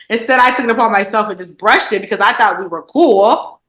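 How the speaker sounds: usual speaking voice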